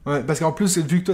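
ordinary talk, nothing marked

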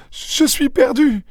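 very high, drawn-out moan; despairing moan. A narrator's reading. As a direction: wail it slowly in a high woman's voice. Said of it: "gémit-elle".

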